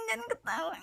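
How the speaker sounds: giggling while scolding; laughing through it. Acted. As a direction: try to stay stern but laugh. Breaks into laughter herself. (sambil tertawa geli)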